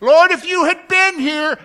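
high, loud reproachful cry; grieving Martha. Retold or imitated. Martha reproaching Jesus over her dead brother, loud. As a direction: reproach him through grief. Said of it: "she goes out to meet him".

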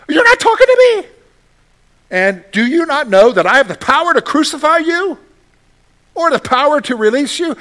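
a ruler boasting of his power; angry Pilate. Acted. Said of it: "Pilate gets a little upset".

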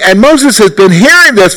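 normal talking voice

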